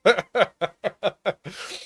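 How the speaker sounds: high mocking chuckle; knowing laugh. Real, not acted. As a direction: laugh at the obvious grift. Laughs at the affiliate code.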